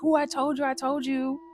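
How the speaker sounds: high gleeful imitation of the cousins; excited told-you-so. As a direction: crow it like you were proven right. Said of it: "And they're like".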